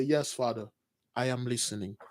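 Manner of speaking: very soft, low answer; hushed reverent reply. Acted. Little Samuel answering the voice at night, hushed and low. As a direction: answer quietly, almost under the breath.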